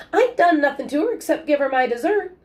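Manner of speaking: put-on high, put-upon old man protesting; aggrieved old grandfather. A narrator's reading. Grandfather defending himself to his grandson, high and aggrieved. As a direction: plead innocence like a wronged grandpa. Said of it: "grandfather protests, aggrieved".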